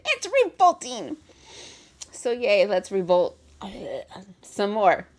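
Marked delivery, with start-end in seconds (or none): 0.0-1.2 high, loud burst of outrage; mock movie shouting. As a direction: belt it out like an appalled film heroine. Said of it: "That's all I hear in my head".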